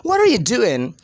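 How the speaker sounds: "What are you doing" in one run-together sound; connected speech. Shown as a demonstration. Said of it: "So in one single sound".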